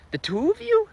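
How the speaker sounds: squeaky high disbelief; can't believe just two did it; hammed-up shocked squeak. Shocked constable quote.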